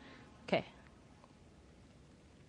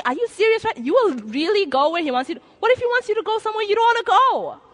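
high, louder, disbelieving inner voice; incredulous; 10 semitones higher, 4 dB louder than usual. Retold or imitated. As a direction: challenge the congregation's sincerity in your head. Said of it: "But I'm thinking".

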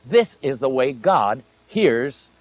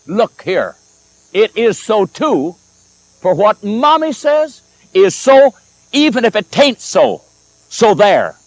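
put-on rising, louder childish insistence; indignant child; 5 semitones higher, 6 dB louder, slower than usual. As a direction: stamp your foot like an upset little girl. Upset little girl insists on mother.

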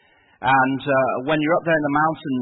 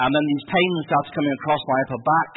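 usual speaking voice

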